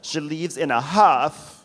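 slow down and lean hard on "huff"; stress on huff. Real, not acted. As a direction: draw out the line, landing on "huff". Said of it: "emphasis on huff".

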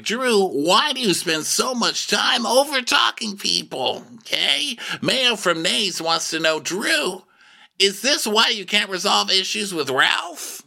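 high-pitched mock interrogation; imitated reunion host. [name] putting a viewer's question to Drew, mock host voice. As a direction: put the question like a hammed-up TV host.

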